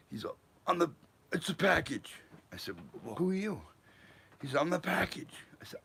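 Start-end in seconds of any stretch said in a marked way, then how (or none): 0.7-2.1 high, louder boastful voice; imitating Sid. Sid tells the skaters who he is, high and puffed up. 3.0-3.6 high, louder questioning voice; mock puzzled question. Teller asks the stranger in the car, high and loud. Teller quotes himself to Sid. 4.6-5.3 high, louder boastful voice; imitating Sid. Sid tells the skaters who he is, high and puffed up.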